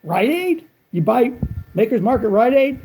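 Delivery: high, incredulous question; surprised disbelief. Retold or imitated. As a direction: act amazed he bought it there. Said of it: "and he goes".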